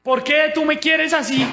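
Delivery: loud, high-pitched cry of complaint; reenacted anguished yell, words stretched out; angry, hurt protest. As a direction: shout at God, indignant and wounded.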